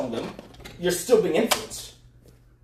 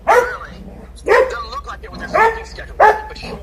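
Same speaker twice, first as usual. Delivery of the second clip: much higher, louder, mocking disbelief; biting sarcastic comeback; sarcastic jab; 7 dB louder than usual. Real, not acted. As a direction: sneer it at her.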